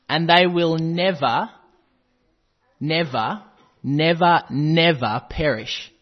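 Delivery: "never" hit loud and drawn out, with pauses; emphatic repetition. Real, not acted. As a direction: hammer each "never" slowly before "perish". Preacher hammers repeated word in verse.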